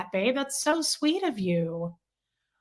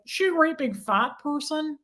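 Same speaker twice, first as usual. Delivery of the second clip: weird, higher-pitched villain voice; Buffalo Bill; 6 semitones higher than usual. Retold or imitated. Fan quoting Ted Levine's villain line, weird imitated voice. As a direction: imitate an odd movie killer's voice, pitched up. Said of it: "He's got the weirdest voice".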